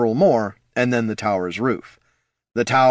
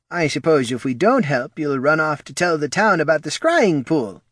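high, needling character voice; grudging official, pitched up; suspicious counselor; 7 semitones higher than usual. A narrator's reading. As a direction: needle them about their motives.

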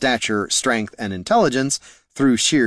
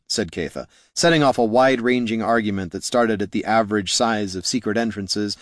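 usual speaking voice

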